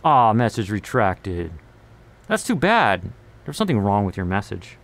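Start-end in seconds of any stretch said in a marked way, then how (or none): none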